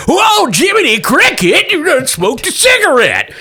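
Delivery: high, startled shout, slowed down; old prospector. Acted. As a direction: yelp it like a spooked old miner. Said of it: "He goes".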